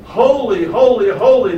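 forceful preacher's praise; emphasizing holiness. Acted. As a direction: proclaim each holy strongly. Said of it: "Emphasizing God's nature".

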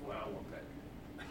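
slow, breathy whisper of wanting; covetous king whispering to himself; greedy whisper. Acted. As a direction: hiss the craving slowly.

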